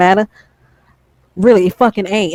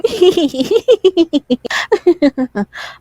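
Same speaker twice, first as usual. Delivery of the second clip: laugh; 10 semitones higher than usual. Real, not acted. Mocking the laughing add-on.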